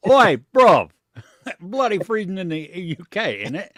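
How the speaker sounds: put-on laughing cockney British accent. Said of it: "You just gotta slap an in it on the".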